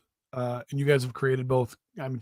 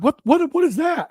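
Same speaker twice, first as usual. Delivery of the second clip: much higher, startled what; dad startled by son's cracking voice, much higher; mock shock; 12 semitones higher, 4 dB louder than usual. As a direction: react as if shocked by a strange noise.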